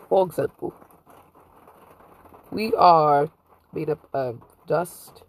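ordinary talk

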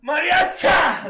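high drawn-out yell; stretched shout. Retold or imitated. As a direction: belt the name slowly, very high.

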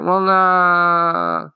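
high, loud, drawn-out mock "nah"; reluctant teacher. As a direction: imitate a teacher brushing off a request. Teacher's hesitant refusal, acted.

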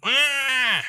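put-on high, loud toddler shout; toddler yell. His youngest pointing at the fridge, high toddler yell. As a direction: yell like a demanding little kid. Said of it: "my youngest goes".

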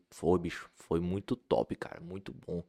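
lightly voiced Tom, dropping low; imitating Tom Cavalcante. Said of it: "A voz dele é grossa".

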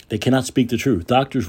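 usual speaking voice

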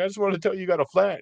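high mock guy's voice; S0 mimics the man explaining about the flat. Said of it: "he's like".

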